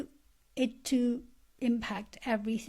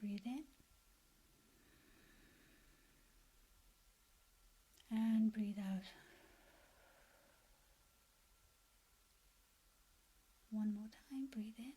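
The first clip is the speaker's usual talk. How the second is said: guided meditation. Acted. Coach leading listeners to picture their best life, guided meditation. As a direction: guide them gently, leaving space after each phrase.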